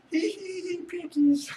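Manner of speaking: high, squeaky giggling voice; squeaky giggle. Pretend. Streamer teasing a viewer in a squeaky giggling voice. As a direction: giggle it like a tiny cartoon imp.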